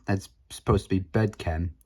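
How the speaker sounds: ordinary talk, nothing marked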